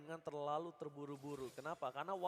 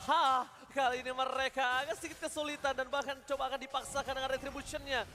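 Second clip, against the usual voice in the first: rising to high, loud shouts; excited play-by-play; 4 semitones higher, 4 dB louder than usual. Real, not acted. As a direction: build up to a hype peak. Caster hypes a fight.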